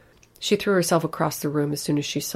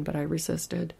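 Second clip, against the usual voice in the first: ordinary delivery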